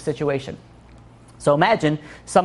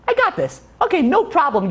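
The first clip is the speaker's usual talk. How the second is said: confident relief; 9 semitones higher than usual. Retold or imitated. Suspect confident once he knows.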